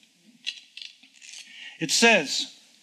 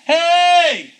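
high, loud demonstrative shout; sudden full-voiced holler; top-of-voice yell; 11 semitones higher, 8 dB louder than usual. Shown as a demonstration. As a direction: yell it as loud as you can. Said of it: "cry at the top of one's voice".

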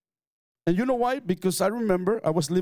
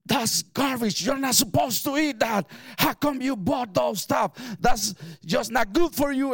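scolding sister; 7 semitones higher than usual. Retold or imitated. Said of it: "she started just yelling at me".

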